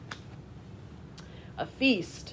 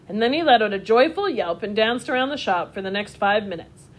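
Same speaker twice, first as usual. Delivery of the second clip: ordinary delivery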